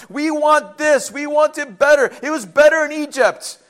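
loud, high, insistent demands; demanding complaint. Acted. Hebrews in the desert complaining to God, demanding. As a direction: draw out each demand, pushed high.

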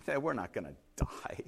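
low, soft, laid-back reply; easygoing and hushed, pitched well down; unbothered castaway. Retold or imitated. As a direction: brush off the panic quietly. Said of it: "his arms are folded".

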